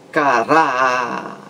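"caráter" devagar, com o RÁ prolongado e pausa antes de ter; ênfase na penúltima sílaba. Shown as a demonstration. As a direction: segure o RÁ de "caráter". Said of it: "A sílaba mais forte é a penúltima". ("caráter" como ca-rááá... ter)

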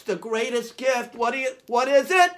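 normal talking voice

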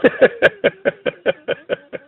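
sudden high burst of laughter; high mocking laugh. Real, not acted. Laughing at the scammer.

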